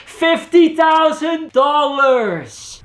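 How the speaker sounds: high, slow shout, dragging each word; shouted from the back room. Retold or imitated. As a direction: call the price out to a room far away. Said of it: "his brother would scream back".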